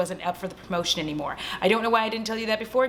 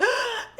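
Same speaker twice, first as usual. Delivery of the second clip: high, sharp imitated gasp; mock shocked gasp; 13 semitones higher than usual. Justin watching her vanish, mock shocked gasp. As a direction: suck in air in pretend shock.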